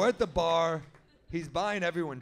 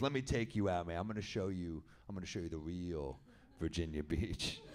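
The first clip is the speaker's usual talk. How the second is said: much lower and softer, a local tough guy; Stu's voice; 9 semitones lower, 6 dB quieter than usual. Retold or imitated. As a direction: drop way down into Stu's low voice. Stu voiced, boasting.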